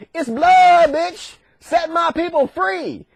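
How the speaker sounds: high-pitched, drawn-out ultimatum; mock defiant demand. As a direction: demand it with hammed-up bravado. Moses makes his demand.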